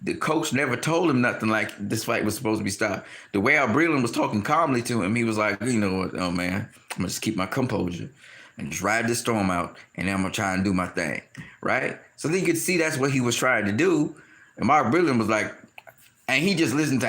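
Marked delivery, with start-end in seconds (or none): 5.6-11.2 lower, steady inner voice; calm self-talk. Retold or imitated. Wilder talking himself through the storm, calm and low. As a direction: imitate a fighter calming himself down. Wilder keeping composure, retold.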